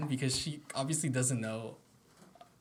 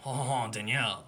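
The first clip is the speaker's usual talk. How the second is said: put-on French accent. Shown as a demonstration. Host teasing the friend about the name, French accent. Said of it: "Danielle with a French accent".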